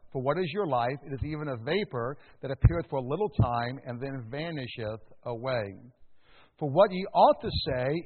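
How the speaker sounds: measured, solemn Bible reading; scripture reading. Acted. Preacher reading James four to the congregation, solemn.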